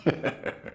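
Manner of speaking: soft, breath-only chuckle; approving chuckle. Acted. Old lord hearing his lesson completed, approving chuckle.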